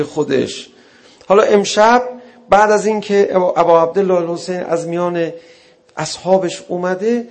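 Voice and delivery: loud, driving mourning sermon; full-force preaching. Acted. Preacher drives the night's mourning to the congregation, loudly. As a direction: push the lament hard to the crowd.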